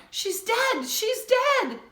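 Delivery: very high, loud wail; panicked high shouting; crying out. A narrator's reading. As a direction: keep crying it out. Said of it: "he didn't stop crying out".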